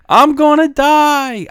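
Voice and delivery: high, slow, loud mock wail; mom mocking him. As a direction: ham up the scared kid's cry. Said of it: "the first words out of her fucking mouth will be".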